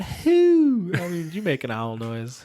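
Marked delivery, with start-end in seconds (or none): 0.0-0.9 "who" said high as a plain word, no hoot; wrong owl sound. Shown as a demonstration. Showing the wife's wrong owl sound, "who" as a word.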